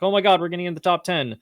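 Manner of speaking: retold excitement. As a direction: imitate a team thrilled by the result. Retells team's excited reaction.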